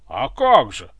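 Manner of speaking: loud, emphatic assent; barked reply. A narrator's reading. Guards answer the tsar in chorus, barked. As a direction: bark it out promptly. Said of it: "хором рявкнули охранники".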